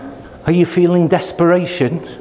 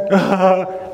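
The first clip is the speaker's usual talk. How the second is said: short amused chuckle; light laugh; 4 semitones higher than usual. Retold or imitated. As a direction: laugh off your own cheeky answer. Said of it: "and then laughs".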